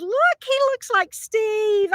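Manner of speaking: high, drawn-out excited exclamation; excited recognition. Retold or imitated. Telling a friend about a lookalike, high and excited.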